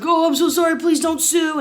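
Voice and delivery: high, hurried-sounding begging imitation; mock panicked pleading. Dale to the man he hit, imitated panicked apology. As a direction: beg pitifully, pitch way up.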